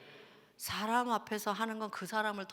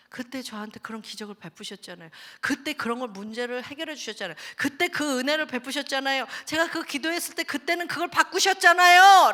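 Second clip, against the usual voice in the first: rising higher and louder in thanks; building grateful prayer; 4 semitones higher, 11 dB louder than usual. Retold or imitated. Acted grateful confession. (점점 높고 크게 벅차오르는 감사 고백)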